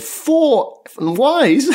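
high, disbelieving question with a laugh; incredulous. Retold or imitated. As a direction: sound like you can't believe it, laughing. Golfer stunned by the read.

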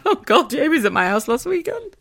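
high-pitched mock alarm, laughing; mock shocked witness. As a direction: play the horrified witness, cracking up. Mock witness to crime appeal.

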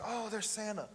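high, hushed excitement; excited elf. Retold or imitated. Elf sees Santa in the store, softly excited. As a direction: imitate Buddy the Elf spotting his hero. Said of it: "he's getting real excited".

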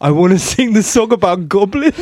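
put-on laughing kid's request. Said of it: "I was like".

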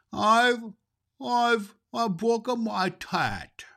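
put-on high, stammering child's voice, on the edge of tears; a small girl choking out bad news; high child voice, hitching and slow with sobs; sobbing little girl. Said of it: "sobbed Mary".

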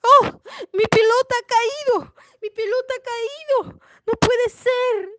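high, hammed-up wailing with sobs; mock-crying, high and drawn into sobs; desperate crying. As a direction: act out a princess bawling in despair. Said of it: "se puso a llorar, se desesperó".

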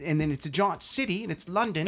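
soft mock Kiwi pitch; Peter Jackson. Director pitching to a Fox executive, mock Kiwi accent. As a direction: put on a light New Zealand accent, quietly. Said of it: "with his little Ki-, Kiwi accent".

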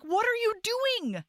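high-pitched imitated exasperation; urgent protest. Fallen heroine to the man who stopped, high exasperated protest. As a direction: demand it high, as if he's wasting time.

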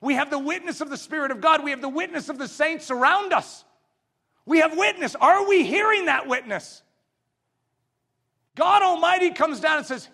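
high, loud pulpit proclamation; ringing, insistent sermon delivery; full-force preaching. Real, not acted. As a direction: preach it out to the whole congregation.

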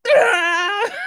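high loud mock yell breaking into laughter; retold scream then laugh. Helper shocked on the ramps, a mock yell dissolving into laughs. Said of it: "I'm sitting there and I hear".

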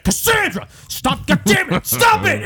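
high imitation of a scolding woman, building to a shout; yelling. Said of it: "she keeps yelling".

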